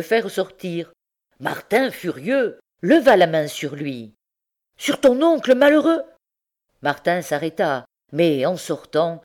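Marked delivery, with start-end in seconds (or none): 4.8-6.0 outraged shout. A narrator's reading. Uncle threatened with a raised hand.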